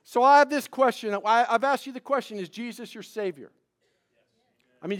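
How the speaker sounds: loud, high, earnest preaching. Acted. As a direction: press the question on the church. Preaching push.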